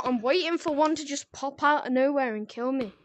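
normal talking voice

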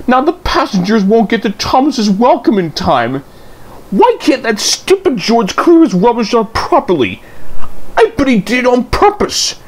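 indignant complaint. Acted. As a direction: rant about George's rubbish. Daisy complains angrily.

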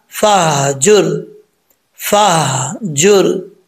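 slow drawn-out फहजुर with sukoon on ह; Quranic recitation. Shown as a demonstration. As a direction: recite फहजुर slowly, holding the ह. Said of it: "हा के ऊपर जज्म है". (धीमे तजवीद से फहजुर)

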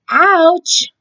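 high, hammed-up little ouch; frog's mock ouch. Frog complains logs give splinters, high mock ouch. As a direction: squeak it like a pretend splinter hurt.

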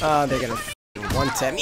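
ordinary delivery

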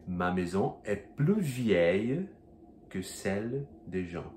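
Ma maison est plus vieille, slow, plus with no S; silent S. Shown as a demonstration. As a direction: say plus vieille slowly, dropping the S. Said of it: "Então eu diria".

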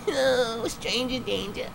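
very high, slow whimpering falsetto; mock whimper. Mock victim voice.

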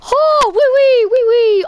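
a cartoon Frenchman gushing oui oui; mock French teacher. Player hams up French teacher's oui oui, mock French accent.

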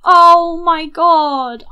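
loud, slow drawn-out wail; exasperated cry. Real, not acted. A loud, slow cry of dismay over her tense Sim. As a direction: let the panic burst out slowly. Sim stressed before exam.

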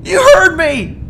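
unload on him, voice breaking; tearful rant. Acted. Morty's tearful tirade at Rick.

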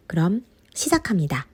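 숨죽여 속삭이며 시작을 알리기; 비밀 이야기하듯 조용히; 속삭임. Acted. Dramatic hushed start of game. (속삭이듯 게임 시작을 알리는 목소리)

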